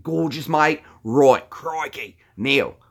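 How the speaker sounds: broad Aussie croc-wrangler showman; Steve Irwin. Acted. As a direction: push the Aussie accent into giddy excitement. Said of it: "that's Steve Irwin".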